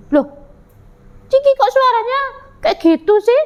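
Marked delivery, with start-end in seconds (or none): 1.3-3.4 high-pitched teasing little animal; mocking. Cat mocks Ciki's voice, high and taunting. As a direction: tease the chick in a high voice. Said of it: "ngejek dia".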